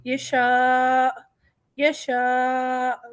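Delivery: یشاء slow, long aa ending in a clear hamza catch; stop on hamza. Shown as a demonstration. Teacher shows the hamza stop. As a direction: hold یشاء then clip the hamza. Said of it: "ہم حمزہ پر روک دیں گے".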